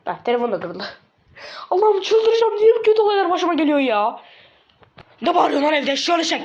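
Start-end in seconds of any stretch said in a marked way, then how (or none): 1.7-4.2 high, loud, exasperated wail; frantic outburst. Acted. Boy whose phone just broke, high frantic yelling. 5.2-6.4 raised, higher-pitched telling-off; father's scolding. Acted. Father scolds his son for yelling at home, raised angry voice.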